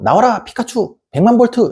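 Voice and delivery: high, shouted trainer command; Pokemon trainer call. Pretend. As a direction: throw the ball and call out your Pikachu. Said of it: "몬스터볼 딱 던져갖고". (포켓몬 트레이너처럼 높게 외치는 소리)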